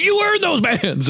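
high, slow shouted praise; yelled praise. Retold or imitated. Hunter yelling to his friend about earned bands, high and slow. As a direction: yell it out, pumped up. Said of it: "And I yelled it".

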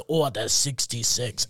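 low, slow, soft villain's command; hushed, drawn-out sinister decree; Emperor Palpatine. Retold or imitated. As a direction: give the order slowly and darkly. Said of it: "what Emperor Palpatine says".

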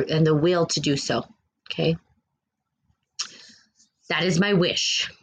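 emphatic, deliberate preaching with long pauses; impassioned declaration. Real, not acted. Streamer declaring a wish for people's safe passage, solemn and emphatic. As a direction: proclaim it in short, weighty phrases.

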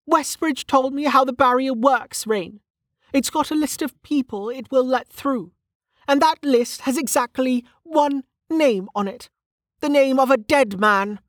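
high-pitched character voice, bitter explanation; Velika, bitterly explaining. Acted.

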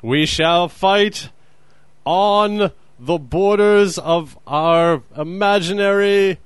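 slow, high, loud grand wartime speech; mock Churchill oratory. Parodying Churchill's beaches speech with slow, loud pomp. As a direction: orate it like a mock wartime leader. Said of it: "quote from, uh, Winston Churchill".

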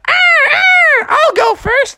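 very high screeching bird voice; squawk, then volunteer in a piercing voice; cartoon eagle, shrieky and high; eager eagle. Eddie the Eagle voice.

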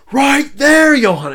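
high, loud insistent call. Retold or imitated. Crew member insisting to Johan, high loud call. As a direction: shout it and point hard.

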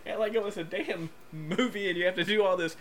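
high-pitched impatient complaint; laughing exasperation. Real, not acted. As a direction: gripe loudly through laughter at the long buildup. Impatient for the song to play.

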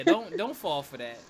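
emphatic warning. Real, not acted. Warning the audience.

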